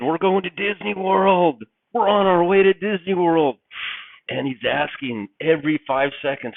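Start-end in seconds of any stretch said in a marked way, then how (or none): 0.0-3.6 put-on higher, louder excited kid; elated kid announcing the trip, drawing out the words; elated child. Child on a family trip to Disney World, elated.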